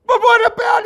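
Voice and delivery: very high shouted interruption; insistent Italian son. Retold or imitated. Son pestering Papa further, high mock shout. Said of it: "this Italian guy goes home".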